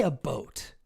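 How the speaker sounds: about pronounced "a boat"; exaggerated Canadian "about". Shown as a demonstration. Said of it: "I don't think I say".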